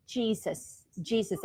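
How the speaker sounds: soft, breathy sigh, then a quiet plea to Jesus; pained call to Jesus. Retold or imitated. Patient in pain calling on the Lord, quiet plea. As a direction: sigh it out and murmur the name softly. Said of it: "and I'm like".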